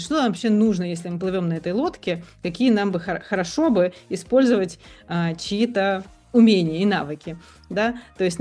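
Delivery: ordinary delivery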